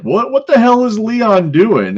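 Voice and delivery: high, loud, slow mock disbelief; retold disbelief. JBL asks about Leon in high mock disbelief. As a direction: play JBL shocked at the sight. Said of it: "And he's like".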